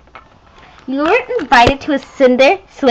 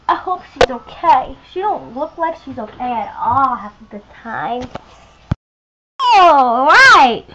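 ordinary delivery

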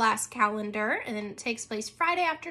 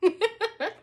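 short laugh; 9 semitones higher than usual. Real, not acted. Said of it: "moment of silent screaming".

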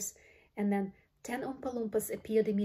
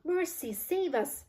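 high, slow wail of dismay; drawn-out high cry of horror; mild yell; 8 semitones higher, slower than usual. A narrator's reading. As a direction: wail for help. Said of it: "yelled Missus Beauregard".

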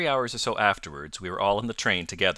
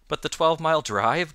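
high, louder startled question; astonished gasp; 8 semitones higher, 5 dB louder than usual. A narrator's reading. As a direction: blurt it out in disbelief. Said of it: "gasped Hatherley".

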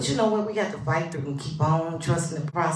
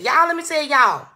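higher and louder, grabbing the room; loud call for attention; 8 semitones higher, 6 dB louder than usual. Acted. Host calling her viewers to listen, loud and high. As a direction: belt it out to get everyone listening. Streamer launching into a story.

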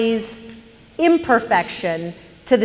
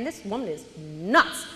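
ordinary talk, nothing marked

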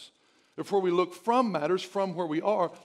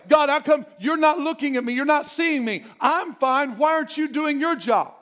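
high, loud indignant complaint; frustrated complaint; 8 semitones higher, 7 dB louder than usual. Acted. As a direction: demand answers from God. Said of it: "so we gotta be real".

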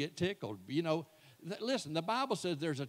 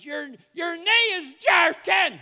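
loud, high, slow startled yell; wife's shout; 14 semitones higher, 14 dB louder, slower than usual. Retold or imitated. Wife shouting at her sleeping husband, loud and high. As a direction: bark it out to wake a sleeping husband. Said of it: "all of a sudden she says".

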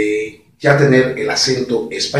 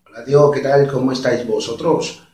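imitated Castilian accent at usual pitch; Spain Spanish accent. Shown as a demonstration. Showing step one to viewers, Castilian accent. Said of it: "un español, de España".

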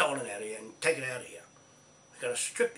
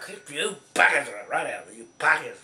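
angry; 8 dB louder than usual. Real, not acted. Ranting at the government.

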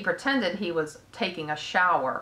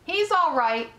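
higher, insistent reassurance; crying out; 9 semitones higher, 4 dB louder than usual. A narrator's reading. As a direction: cry it out to the crowd, mildly anxious. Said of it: "cried Mister Zuckerman".

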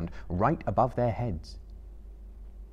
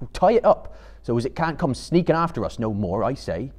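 a louder, rough country hobbit voice; gruff Sam; 4 semitones higher, 6 dB louder than usual. Acted. Sam urging Frodo to tie up Gollum, gruff and loud. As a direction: bark the plan bluntly.

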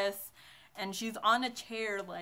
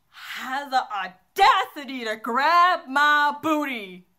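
loud, slow, higher-pitched indignation; outraged storytelling; 4 semitones higher, 10 dB louder, slower than usual. Real, not acted. Vlogger telling viewers a guy groped her, loud theatrical outrage. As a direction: punch it out in drawn-out, loud outrage.

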